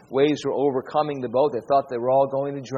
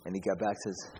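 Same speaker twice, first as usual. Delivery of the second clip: usual speaking voice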